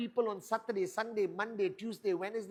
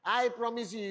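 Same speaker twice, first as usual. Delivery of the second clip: ordinary delivery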